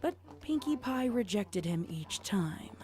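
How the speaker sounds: usual speaking voice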